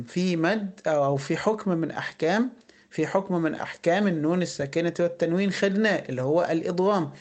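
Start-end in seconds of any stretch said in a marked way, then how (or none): none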